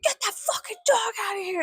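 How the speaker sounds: high, strained screech without much volume; squeezed, high-pitched mock yell; mock screaming. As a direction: imitate the screaming neighbor. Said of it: "And she was screaming".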